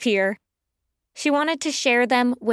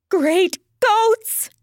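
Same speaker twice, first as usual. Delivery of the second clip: high-pitched cry of shock; startled exclamation; 9 semitones higher, 4 dB louder than usual. Acted. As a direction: yelp it in storybook alarm. Pearl startled by more tugs.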